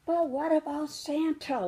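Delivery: put-on high, small child's voice, curious; little cub asking. Cub asks her mother about Santa, in a high curious voice. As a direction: ask it like a wide-eyed little cub. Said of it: "Little Cub asked".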